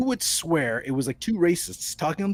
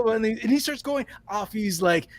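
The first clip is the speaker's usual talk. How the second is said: normal talking voice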